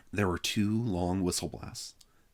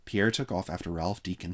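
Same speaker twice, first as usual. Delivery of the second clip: ordinary delivery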